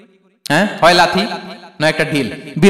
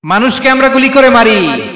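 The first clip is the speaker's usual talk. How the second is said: high, loud, drawn-out preacher's cry; impassioned outcry; 5 semitones higher, 5 dB louder than usual. Real, not acted. Preacher reproaching the congregation for shooting people, impassioned cry. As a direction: cry it out slowly in indignant reproach.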